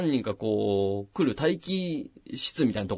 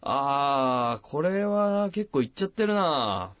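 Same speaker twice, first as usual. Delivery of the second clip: slow, drawn-out grave assessment, pitched up; imitated doctor; 6 semitones higher, slower than usual. Doctor overheard through the curtain judging the X-ray, slow drawn-out imitation. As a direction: stretch out the vowels like a doctor studying an X-ray. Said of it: "お医者さんの声が聞こえてきてて".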